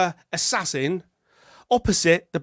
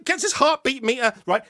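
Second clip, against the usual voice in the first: ordinary talk, nothing marked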